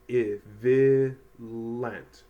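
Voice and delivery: "violent" drawn out as "vi... lent", short i; sounding out. Shown as a demonstration. Teacher trying a word for students, sounding it out. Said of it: "try to say the word".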